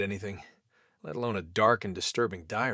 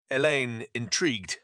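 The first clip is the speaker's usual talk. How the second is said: ordinary delivery, nothing marked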